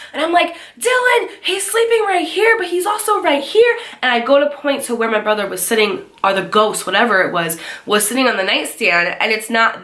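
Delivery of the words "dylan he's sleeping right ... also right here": very high-pitched panicked explaining; frantic. Retold or imitated. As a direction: squeal it out in mock panic. Retold frantic explaining to mom.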